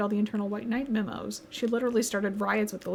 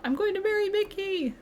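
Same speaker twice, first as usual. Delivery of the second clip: high-pitched fangirl declaration; acted lovestruck fan, pitched way up; gushing fangirl; 9 semitones higher than usual.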